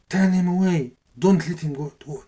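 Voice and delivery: loud, high-pitched command; retold shouted order. Tyrant to guards, turn him from Kaaba, retold command.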